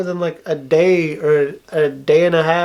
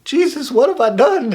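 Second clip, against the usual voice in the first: laughing disbelief; 9 semitones higher than usual. Retold or imitated. Retold own shocked reaction.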